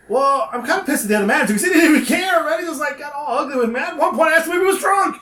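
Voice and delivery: high-pitched retold rant; worked-up complaint. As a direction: vent about the rude manager, climbing as you go. Retold rant to second manager.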